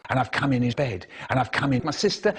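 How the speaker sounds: ordinary talk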